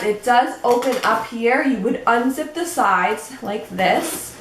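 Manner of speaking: ordinary talk, nothing marked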